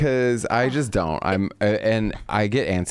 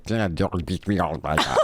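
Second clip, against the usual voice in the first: lower, laughing mock Welsh lilt; a hammed-up Welsh voice through laughter; mock Welsh accent; 5 semitones lower than usual. Shown as a demonstration. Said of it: "But Welsh is more like".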